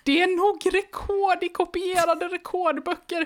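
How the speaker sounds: put-on boy squeaking about a record; squeaky piping Gunnar. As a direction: pipe it out like an excited kid. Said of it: "piper Gunnar".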